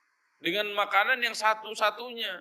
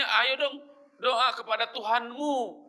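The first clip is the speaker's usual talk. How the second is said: mock bossy order, pitched higher; cocky command; 6 semitones higher, slower than usual. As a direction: order the prophet around, half-mocking. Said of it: "ini songong sekali". (perintah songong, sedikit meniru, nada lebih tinggi)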